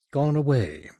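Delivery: a thin, melodramatic sigh on "Gone away"; thin, longing voice. Acted. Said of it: "The voice became melodramatically thin, a whisper of longing".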